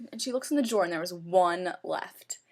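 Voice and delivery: usual speaking voice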